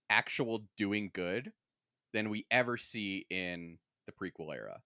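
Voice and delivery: ordinary talk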